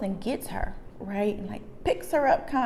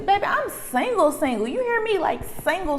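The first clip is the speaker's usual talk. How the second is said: sassy indignation; 9 semitones higher than usual. Acted. Acted indignation for the jilted woman.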